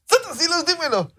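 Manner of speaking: súplica muy aguda y sobreactuada; voz rara de imbécil. Pretend. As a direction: ruega como personaje bobo, en agudo. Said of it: "estabas hablando como raro". (exclamación impostada de parodia)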